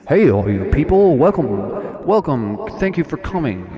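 loud, grand welcome as the guru; booming stage host greeting the crowd; mock guru host. Guru welcoming his audience, loud and grand. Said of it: "he went in and he said".

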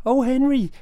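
wife nagging Henry, mock high voice. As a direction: squeal it like a bossy wife. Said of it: "his wife turns up and goes".

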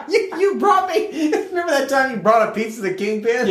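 high, cracking-up delivery; helpless giggly retelling; laughing. Real, not acted. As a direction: barely get it out through real laughter. Cracks up at the Kingpin bit.

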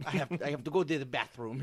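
quick put-on voice of an impostor; hurried excuse in a fake voice; fake Matt voice. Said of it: "find his Matt voice again".

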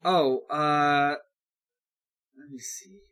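normal talking voice

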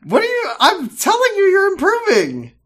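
high, loud laughing teasing. Real, not acted. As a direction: laugh through the praise. Teasing praise through laughter.

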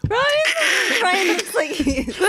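laughing so hard the voice breaks; cracking up mid-sentence. Real, not acted. Laughing through the line.

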